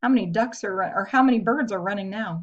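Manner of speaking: usual speaking voice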